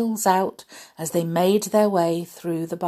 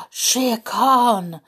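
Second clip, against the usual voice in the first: ordinary delivery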